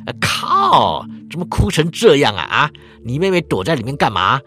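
put-on loud big-mouthed kid calling out to friends; loud kid leader. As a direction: bellow it like the brash leader of the gang. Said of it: "嗓门很大".

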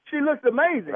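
high, indignant echo question; incredulous outrage. Real, not acted. Husband repeating the doctor's compliment, incredulous. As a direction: throw the words back in disbelief. Husband throws words back.

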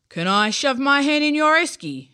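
creature voice. Acted. Creature voice continues.

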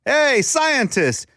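very high, drawn-out hail; mock calling out. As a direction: call across a room, stretching the words. Absurd example of calling out.

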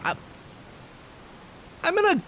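high, rushed and nervous stammer; panicked man voice. Acted. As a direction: blurt it out in a nervous rush. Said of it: "and he says".

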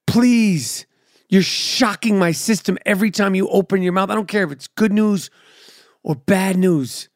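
ordinary talk, nothing marked

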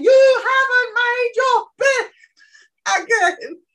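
loud, high-pitched parental yell. Acted. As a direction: yell the scolding, stretching out the words. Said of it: "instead of yelling".

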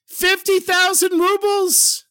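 high, drawn-out cry of amazement; thrilled exclamation. Acted. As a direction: exclaim it slowly, way up high in wonder. Thieves discover the treasure, rejoicing.

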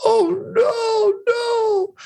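high, wailing sob of despair; crying wail. A narrator's reading. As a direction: break into a high cry over the book. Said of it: "and he starts to cry".

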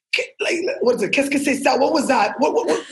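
high-pitched imitation of an outraged designer, laugh creeping in; mock angry. Designer confronting model backstage after the runway stunt, mock angry. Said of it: "looked like he was angry with me".

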